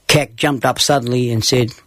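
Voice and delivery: usual speaking voice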